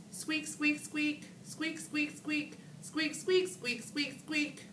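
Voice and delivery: high squeaky chair sounds in rhythm; rhythmic squeaky chair imitation; chair squeaks. A narrator's reading. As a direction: squeak like jingle bells.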